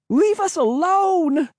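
very high, slow, pleading scream; a girl screaming at her pursuer to stop; high, frightened plea; desperate cry. A narrator's reading. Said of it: "Cassie cried".

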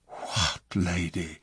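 breathless, whispered shock; stunned whisper. A narrator's reading. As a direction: gasp it out in disbelief. King stunned by news.

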